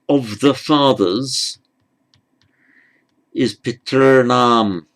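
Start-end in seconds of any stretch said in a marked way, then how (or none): 3.6-4.9 piternam with the "er" held out long; long syllabic R. Shown as a demonstration. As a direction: slowly stretch the middle of piternam. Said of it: "with a long er".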